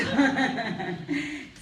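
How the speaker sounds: laughing. Real, not acted. Producer laughing at her own boss joke. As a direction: let the laugh burst out.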